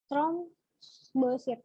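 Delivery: "Trombosit" drawn out very slowly, syllable by syllable; slow repeat. Shown as a demonstration. Tutor repeating the term for students, very slowly drawn out. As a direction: stretch "Trombosit" so students catch every sound.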